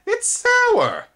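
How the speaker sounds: very high, loud disgusted insect cry. A narrator's reading. Spindlebug after biting Twig, high disgusted cry. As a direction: spit the words out.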